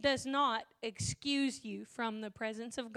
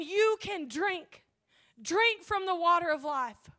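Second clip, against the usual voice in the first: louder and higher, building to a roar; preaching at full force; 7 semitones higher, 8 dB louder than usual. Acted. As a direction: preach it hard, the voice climbing with every line.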